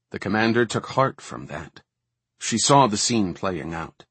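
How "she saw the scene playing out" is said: ordinary delivery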